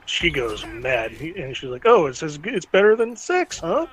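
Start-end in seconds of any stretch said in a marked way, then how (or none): 1.8-3.9 higher, louder sarcastic jab; mocking echo. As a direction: throw his own words back at him. Sypha throws his words back.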